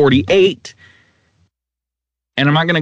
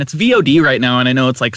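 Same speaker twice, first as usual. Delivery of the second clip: ordinary delivery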